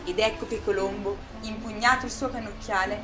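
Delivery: ordinary delivery, nothing marked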